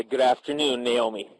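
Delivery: usual speaking voice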